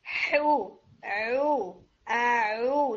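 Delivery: حو then عو, ha and ain from the throat; teacher drilling ha and ain; throat letter drill. Shown as a demonstration. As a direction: contrast ha and ain sounds from the same point. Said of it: "ٹنگ کو بالکل اسٹریٹ رکھیں".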